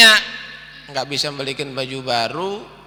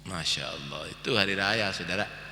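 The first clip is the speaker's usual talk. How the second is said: much softer, lower reverent murmur; awed hush; 6 semitones lower, 12 dB quieter than usual. Real, not acted. Preacher marvelling to the congregation, very soft and low. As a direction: drop to a hushed, awed aside.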